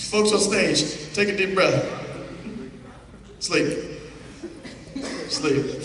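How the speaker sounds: slow, calm sleep command; hypnotic sleep command. Acted. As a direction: lull them down to sleep. Hypnotist putting volunteers to sleep.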